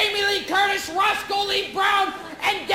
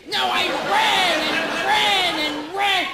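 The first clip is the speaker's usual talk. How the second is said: each "ran" stretched long and slow; emphatic insistence; slower than usual. Acted. Runner correcting an understating listener, drawn-out "ran". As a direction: insist on "ran", drawing out every repeat.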